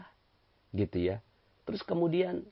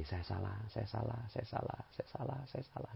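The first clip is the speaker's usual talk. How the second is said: softer and fading, repeated admission; humble admission; 3 semitones lower, 13 dB quieter than usual. Shown as a demonstration. Preacher showing the congregation how to admit fault, softer and fading. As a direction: murmur it humbly, trailing away. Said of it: "Ngomongnya gini".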